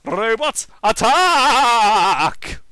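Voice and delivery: loud barked order to attack; rally the robots with a shout; villain command. Acted.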